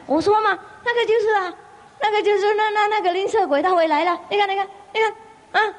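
high, fast, other-voiced family chatter; excited alarm. Family warns each other the miser is back, high fast alarm. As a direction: gasp and point, quick and high-pitched.

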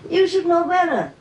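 high, incredulous protest; squeaky disbelieving retort; surprised acquaintance. Retold or imitated. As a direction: voice him high and astonished. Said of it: "acquaintance was very surprised".

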